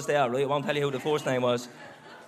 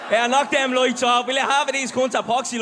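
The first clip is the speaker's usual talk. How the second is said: calling out; 7 semitones higher, 3 dB louder than usual. Real, not acted. Calling to the tech booth.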